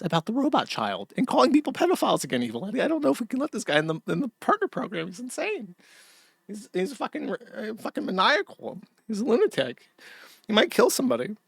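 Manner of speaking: a hint of a panicking employee; nervous reviewer. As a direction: play a jittery company reviewer. Acting nervous platform reviewers.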